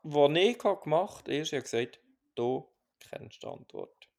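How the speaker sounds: ordinary talk, nothing marked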